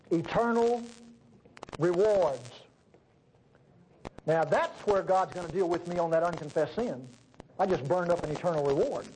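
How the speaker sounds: usual speaking voice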